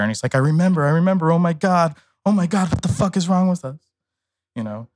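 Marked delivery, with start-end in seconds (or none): 0.3-3.8 high, frantic mock panic; panicked retelling, voice raised high; horrified realization. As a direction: act out a friend freaking out, pitched up. Friend's horrified realisation, retold.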